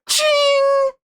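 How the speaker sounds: sound effect. Acted. Sound effect of smoke screen.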